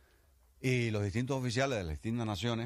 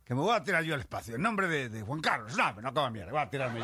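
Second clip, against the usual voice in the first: indignant refusal; 6 semitones higher, 6 dB louder than usual. Acted.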